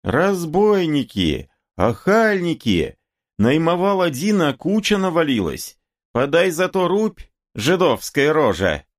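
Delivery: high, wailing indignant woman; woman yelling. A narrator's reading. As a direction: cry out your grievance, pitched high. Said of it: "Баба кричала".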